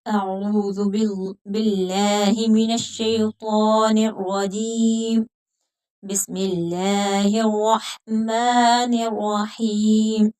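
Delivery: slow, measured tajweed with long held vowels; Quran recitation. Shown as a demonstration. Teacher opens today's lesson, slow tajweed recitation. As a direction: recite slowly, stretching each long vowel.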